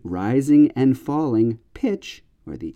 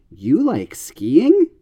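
slow, skiing rising high and a bit louder; surprised echo question; 5 semitones higher, 4 dB louder, much slower than usual. Shown as a demonstration. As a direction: sound amazed as skiing climbs. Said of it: "communicating your surprise".

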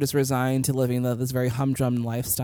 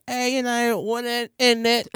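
higher-pitched, hammed-up Cockney; bad Cockney accent; 9 semitones higher than usual. Shown as a demonstration. Host shows co-host how chavs talk, bad Cockney accent. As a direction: put on a terrible mock Cockney. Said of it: "Cockney accents".

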